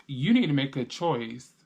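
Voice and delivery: normal talking voice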